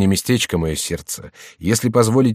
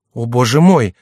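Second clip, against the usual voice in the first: louder, higher exclamation of alarm; fearful cry; 4 semitones higher, 7 dB louder than usual. A narrator's reading. Young woman fears for her beloved king, mildly acted fearful cry. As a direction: cry out with a touch of fear. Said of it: "вскричала молодая женщина с боязнью".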